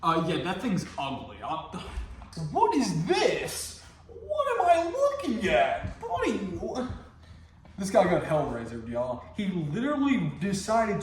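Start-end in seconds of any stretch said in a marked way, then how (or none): 2.5-6.9 baffled. Real, not acted. Disgusted reaction to ugly villain.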